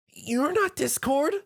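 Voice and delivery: frightened squeak. Acted. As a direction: realize with horror it is an impostor. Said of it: "The Pegasus squeaked".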